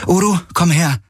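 high, firm call; Kirai calling. A narrator's reading. Kirai calls Uru to come, high and firm. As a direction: summon the animal over.

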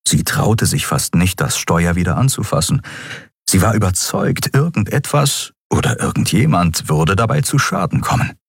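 usual speaking voice

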